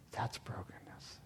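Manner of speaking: hushed, breathy whisper of awe. Real, not acted. Preacher to congregation, a reverent whisper. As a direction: let it fall to a whisper after the story.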